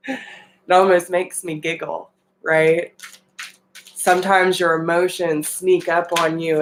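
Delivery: ordinary talk, nothing marked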